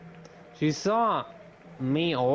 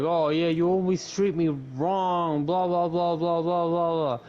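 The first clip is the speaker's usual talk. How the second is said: higher, lightly whiny imitation of a crying boy; mock whiny crying kid; 5 semitones higher than usual. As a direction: mimic his tearful complaint, a bit higher. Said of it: "Sahori started crying".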